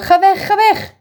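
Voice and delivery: veel hoger en luider: ga weg, ga weg!; angstig schreeuwen. Acted. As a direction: gil het in paniek uit als een doodsbange oude vrouw. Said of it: "begint ze weer te schreeuwen". (de oma schreeuwt angstig naar Peter dat hij weg moet)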